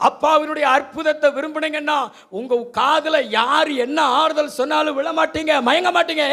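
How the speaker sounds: fiery preaching. Acted. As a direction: drive the point home from the pulpit. Preaching rises to full force.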